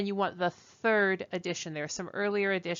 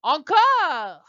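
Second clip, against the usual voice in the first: "Encore" with a French accent, higher and louder; 8 semitones higher, 8 dB louder than usual. Shown as a demonstration. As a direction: call out "Encore" in French to the class.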